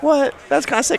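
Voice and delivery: high, loud amazed reaction; excited surprise. Retold or imitated. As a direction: gasp it out, impressed. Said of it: "And I was like".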